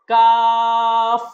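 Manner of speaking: কাফ loud, long, ordinary light k; four-alif stretch. Shown as a demonstration. Teacher models for repetition, কাফ drawn out. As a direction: hold the vowel of কাফ very long and level. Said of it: "এটাও চার আলিফ টান হবে".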